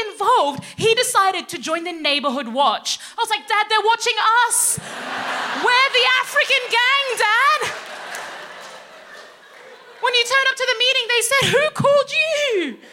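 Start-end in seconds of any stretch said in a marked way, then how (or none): 3.5-12.8 high, loud, urgent warning; panic-warn your oblivious dad; high-pitched exasperated warning; alarmed daughter. Retold or imitated.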